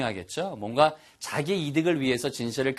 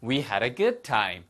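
linked, natural-speed "We had a good time"; quick version; 3 semitones lower, much slower than usual. Shown as a demonstration. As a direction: run the words together smoothly and quicker. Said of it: "빨리 하면".

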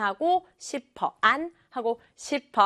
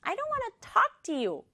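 quicker, high-pitched sulky retort; snapping back; 11 semitones higher, 3 dB quieter, much slower than usual. Acted. Sister refusing her brother, high snappy retort. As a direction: fire it back high and huffy. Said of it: "훅 쏘아붙였어요".

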